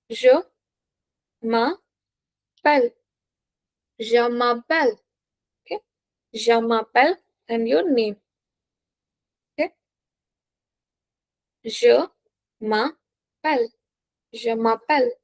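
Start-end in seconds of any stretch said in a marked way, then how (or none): 0.1-2.9 very slow "Je m'appelle", gaps between syllables; slow, separated teaching delivery of "Je m'appelle"; word by word. Shown as a demonstration. Teacher breaks phrase up for learners. 11.6-13.7 slow "Je m'appelle", split into syllables; slow dictation of "Je m'appelle"; word by word. Shown as a demonstration. Teacher breaks phrase up for learners.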